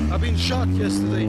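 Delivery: booming fake-serious intro voice; deep dramatic voice. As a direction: drop the voice way down like a movie trailer.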